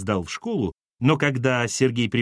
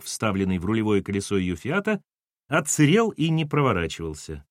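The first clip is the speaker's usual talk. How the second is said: ordinary delivery, nothing marked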